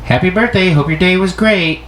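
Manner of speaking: high, bright cheerful greeting; upbeat birthday wish; friendly reading. Shown as a demonstration. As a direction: chirp the wish sweetly. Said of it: "they're gonna read it as".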